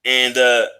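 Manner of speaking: ordinary delivery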